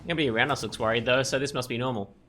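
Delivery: ordinary delivery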